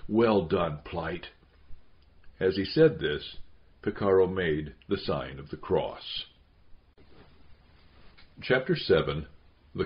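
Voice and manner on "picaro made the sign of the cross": ordinary delivery, nothing marked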